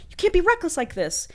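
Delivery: put-on high, scolding girlfriend voice.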